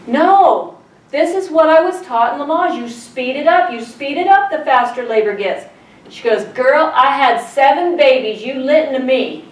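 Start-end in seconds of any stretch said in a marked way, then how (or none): 0.1-5.6 higher-pitched insistent objection; defiant protest. Retold or imitated. Retold protest to the nurse. 6.4-9.4 authoritative nurse. Retold or imitated. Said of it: "she had authority".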